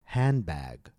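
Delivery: "Handbag" with HAND stressed, bag falling; stress on first noun. Shown as a demonstration. Teacher leading listen and repeat, "Handbag" stressed on HAND. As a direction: hit "Hand", let "bag" drop. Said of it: "Stress the first noun".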